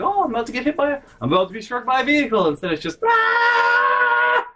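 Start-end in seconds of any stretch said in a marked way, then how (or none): 0.0-2.5 high, rapid mock alarm. Imagining the sensible reaction to a near hit, high fast mock alarm. 3.0-4.5 loud, very high imitated rage scream; long mock shriek of fury; raging scream. Storyteller imitates sister's scream at the driver, raging shriek. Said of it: "in rage and anger".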